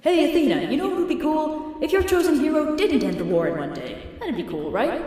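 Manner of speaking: high, wheedling god voice; butter her up with a high, sly tone; high, coaxing mock Apollo. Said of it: "Apollo's like".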